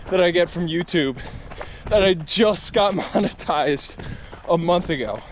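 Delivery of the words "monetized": normal talking voice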